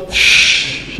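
soft breathy shh; a train's hiss over the announcement; imitated train hiss. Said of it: "a train goes".

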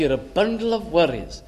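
ordinary delivery

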